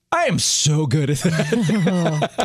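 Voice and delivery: slow, laughing irony; dry self-mocking boast; sarcastic. Real, not acted. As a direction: say it slow with a laugh, meaning the opposite. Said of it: "I have this one down so well".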